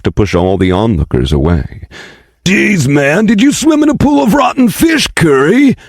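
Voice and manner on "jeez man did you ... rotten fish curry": high, sing-song disgusted jeer; mock him over the stink; disgusted man. Passerby mocks the smell.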